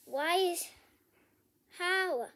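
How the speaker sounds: ordinary talk, nothing marked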